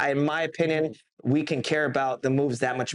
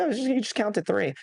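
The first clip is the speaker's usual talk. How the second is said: higher, mocking "just count to three"; lightly mock the know-it-alls; dismissive mock; 6 semitones higher than usual. To the host, mocking people who belittle refereeing, dismissive. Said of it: "People say".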